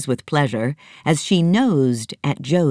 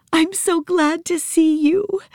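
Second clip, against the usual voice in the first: put-on high girl's voice, tearful; small girl weeping with joy at her elephant; Nancy weeping. Little girl tearfully greeting her elephant, high voice. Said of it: "she wept".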